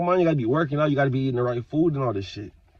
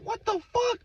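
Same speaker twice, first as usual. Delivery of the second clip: much higher, softer shocked squeak; startled high what-the-hell; 5 dB quieter than usual. Retold or imitated. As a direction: blurt it out in disbelief. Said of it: "And I'm just like".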